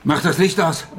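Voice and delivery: urgent shouted command; shouting. Acted. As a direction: yell at her to kill the light. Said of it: "wieso brüllst du mich so an".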